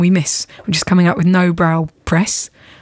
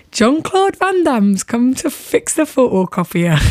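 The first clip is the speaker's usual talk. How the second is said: slow, higher Newcastle accent with stretched vowels; 4 semitones higher, slower than usual. Shown as a demonstration. Friend teaching radio partner a Newcastle accent, slow. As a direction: ham up a Geordie voice for the joke. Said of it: "trying to do a Newcastle accent is".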